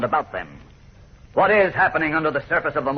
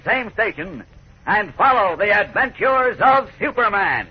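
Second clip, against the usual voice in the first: higher, louder, hyped-up announcer sell; excited radio teaser; 8 semitones higher, 4 dB louder than usual. Acted. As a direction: pump up the kids for tomorrow's episode. Narrator's closing teaser.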